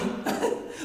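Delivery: imitated short scoffing laugh; brief laugh of disbelief; audible laugh. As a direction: let out a quick audible laugh. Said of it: "I audibly laughed".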